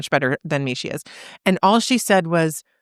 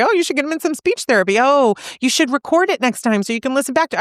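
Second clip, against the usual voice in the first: higher, quick-fire mock suggestions; imitated unhelpful advice-giver; 7 semitones higher, faster than usual. Someone else answering a grieving mother, imitated eager advice. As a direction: pile on well-meant fixes in a raised pitch. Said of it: "what someone else might say".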